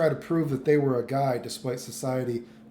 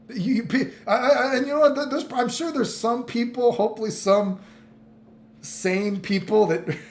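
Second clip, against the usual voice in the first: laughing; 7 semitones higher, 4 dB louder than usual. Real, not acted. Laughing in disbelief at story.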